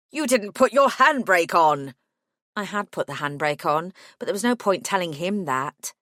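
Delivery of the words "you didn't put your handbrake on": indignant yell at her; angry shout. Acted. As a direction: shout it out in disbelief. Murray yells at narrator.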